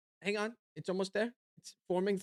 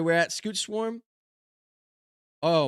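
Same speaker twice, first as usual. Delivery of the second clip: ordinary delivery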